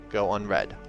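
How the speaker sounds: usual speaking voice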